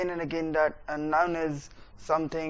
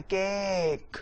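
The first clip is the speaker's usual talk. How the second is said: delay केक and let it hang; delayed stress; much slower than usual. Shown as a demonstration. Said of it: "बस उसको delay करना है". (केक को देर तक खींचें)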